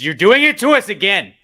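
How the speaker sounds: loud, exasperated yell at the team; rising fury at West Ham; angry outburst. Real, not acted. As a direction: let it burst out in frustration. Angry rant at his team.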